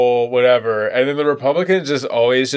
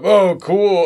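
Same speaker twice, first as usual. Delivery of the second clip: hammed-up gushing, higher and louder; fake enthusiasm; 6 semitones higher, 3 dB louder than usual. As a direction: overplay the interest like a nervous host. Mock awkward small talk.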